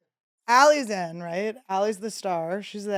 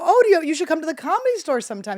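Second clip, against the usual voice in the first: mock eager pitch; 8 semitones higher, 6 dB louder, faster than usual. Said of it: "I go".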